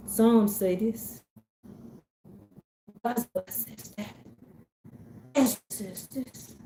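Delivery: imitating a sweet southern speaker, slow and soft. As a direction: recite softly and slowly in sweet southern tones. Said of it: "with her sweet sound and southern voice".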